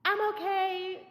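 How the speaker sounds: put-on high, sing-out kid voice; high-pitched mock reassurance from afar; imitated kid calling up. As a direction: call up to the window, mimicking a kid.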